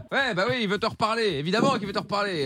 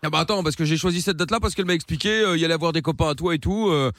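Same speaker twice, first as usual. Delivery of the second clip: lower-pitched put-on accent of the south of France; accent du Sud, voix plus grave; southern French accent; 4 semitones lower than usual. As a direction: play a cocky guy from the Sud with a thick southern French accent. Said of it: "avec ton accent, là, à la con".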